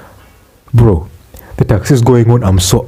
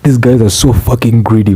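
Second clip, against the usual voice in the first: ordinary talk, nothing marked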